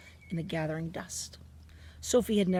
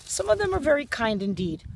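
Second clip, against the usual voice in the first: higher and louder, indignant; angry insistence; 7 semitones higher, 6 dB louder than usual. A narrator's reading. Sophie insisting to the BFG that some people are kind, angry. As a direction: defend them fiercely, pitch up. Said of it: "Sophie cried angrily".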